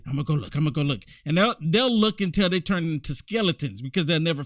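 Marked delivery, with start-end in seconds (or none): 0.1-1.0 low, soft, hurried muttered quote; lightly voiced liberals. Host quoting liberals looking for an example, lightly mimicking. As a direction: mimic them searching, quick and under the breath. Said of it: "they've said".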